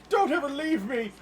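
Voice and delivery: high, loud clingy plea; needy lord pleading. Acted. Shogun to his famous retainer, desperate pleading. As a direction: cry it out in hammed-up desperation. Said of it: "He's like".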